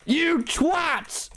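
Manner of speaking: high, drawn-out exclamation; high-pitched exasperated yell; exasperated outburst. Real, not acted. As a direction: cry it out in mock outrage. Mock exasperation at his chat.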